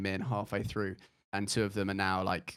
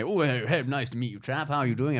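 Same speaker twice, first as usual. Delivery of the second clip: lightly hammed-up posh chap; Boris Johnson impression; 3 semitones higher, 6 dB louder than usual. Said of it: "That was a brilliant Boris Johnson impression".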